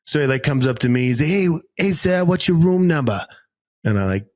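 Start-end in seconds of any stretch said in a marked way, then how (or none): 1.3-3.2 tram driver, higher, broad Boston; friendly Boston "hey, hey, sir"; Boston accent. Retold or imitated. Driver asking me my room number, Boston accent. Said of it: "switch accents quick".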